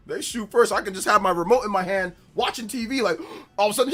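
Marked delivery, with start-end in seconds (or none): none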